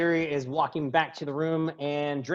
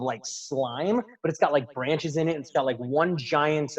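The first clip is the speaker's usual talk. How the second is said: ordinary talk, nothing marked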